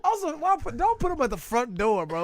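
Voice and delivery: loud, high-pitched exasperation; indignant outburst. Real, not acted. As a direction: protest loudly in disbelief. Indignant joking outburst about patient placement.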